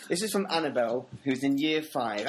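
normal talking voice

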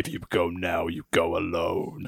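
low, quiet, slow movie line; mock dramatic dub. Dubbing wrestlers' dialogue, low slow mock drama. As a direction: intone it like a solemn hero.

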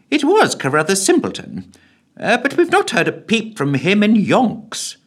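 high official voice; briefing the PM with confidence; secretary. Acted.

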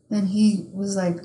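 ordinary talk

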